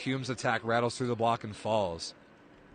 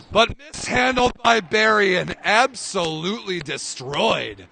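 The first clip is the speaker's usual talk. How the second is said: much higher, louder and slow, savouring the kill; excited big-play call; 9 semitones higher, 11 dB louder, slower than usual. Real, not acted. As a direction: stretch out the hammer moment. Huge kill called live.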